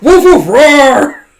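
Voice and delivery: high, loud barks drawn out into a roar; mad dog barking. Retold or imitated. Bond getting mad at the other dog, mock angry barking. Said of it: "he just gets mad".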